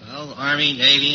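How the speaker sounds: normal talking voice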